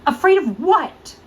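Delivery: high, loud, slow alarmed question; startled question. Acted. Friend reacts to Thomas being afraid, startled. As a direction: jump in alarmed, drawn out.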